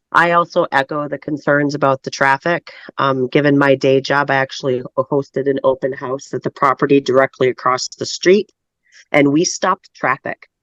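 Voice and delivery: normal talking voice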